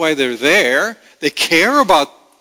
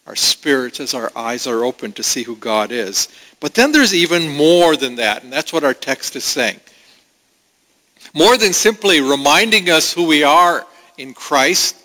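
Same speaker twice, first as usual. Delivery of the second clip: normal talking voice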